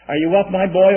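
a hint of a mother's wake-up call. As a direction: call up to a sleepy son. Mother calling up to sleeping son.

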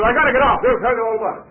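high, loud announcement to a crowd; announcing loudly. Retold or imitated. Old preacher telling the whole coach, loud and high. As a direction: call it out to the whole coach before stepping off.

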